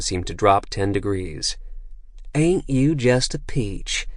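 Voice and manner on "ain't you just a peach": higher, slow, syrupy mock-Southern drawl; exaggerated country drawl. A narrator's reading. Assassin Mindy mocking Pike in an exaggerated country drawl. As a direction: stretch every vowel in a sweet, fake country accent. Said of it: "in an exaggerated country drawl".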